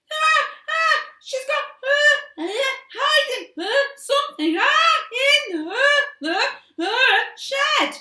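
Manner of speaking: high, loud hammed-up blubbering; fake sobbing. A narrator's reading. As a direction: squeeze out crocodile tears. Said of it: "squeezed out some more tears".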